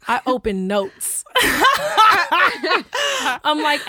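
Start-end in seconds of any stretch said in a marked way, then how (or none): 1.3-3.4 loud, high burst of laughter; shrieking laughter. Real, not acted. Reacting to opening notes for his number, shrieking laughter.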